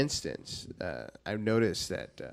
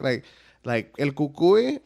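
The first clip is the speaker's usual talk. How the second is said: ordinary talk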